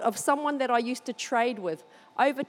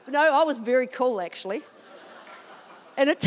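ordinary talk, nothing marked

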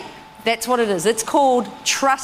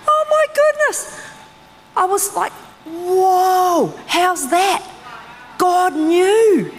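awed exclamation, pitched up; astonished; 8 semitones higher, much slower than usual. Real, not acted. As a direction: marvel that God knew all along. Fund lost seventy percent.

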